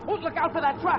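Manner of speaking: sudden high alarmed yell; warning shout. Acted. Lee yelling to Pat about the truck, alarmed shout.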